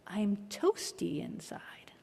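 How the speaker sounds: slow soft whisper. Retold or imitated. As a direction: breathe it out slowly, barely voiced. Grinch's tender transformation moment.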